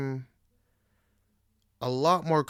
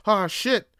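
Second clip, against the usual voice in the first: startled; 10 semitones higher, 7 dB louder than usual. Retold or imitated. Own panic quoted.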